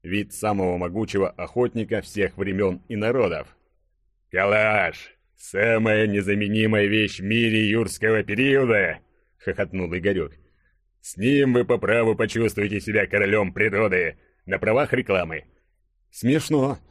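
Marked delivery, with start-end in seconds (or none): 4.3-15.5 mock advert pitch in character voice; jovial burly hero, boastful. Hero praising his rifle after the kill, boastful mock advertising. 16.1-16.8 grumbling. A narrator's reading. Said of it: "проворчал абсолютно голый Денис".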